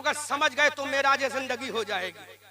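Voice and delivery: ordinary delivery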